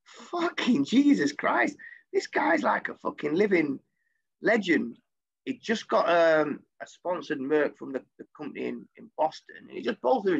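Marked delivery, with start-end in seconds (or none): 0.0-5.0 high, slow awed exclamation; dragged-out amazement; awestruck. Retold or imitated. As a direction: savour the disbelief. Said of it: "I'm like".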